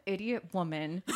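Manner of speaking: ordinary delivery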